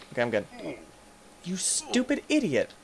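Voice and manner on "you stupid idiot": high squealed insult, falling at the end; exasperated squeal. Real, not acted. Gamer cursing the creature that attacked, high exasperated squeal.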